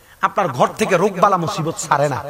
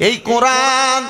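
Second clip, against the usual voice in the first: melodic waz preaching; 3 semitones higher, 4 dB louder than usual. Acted. Preacher tells congregation of a weeping grave, chanted sermon. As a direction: preach the tale in singsong, stretching the line endings.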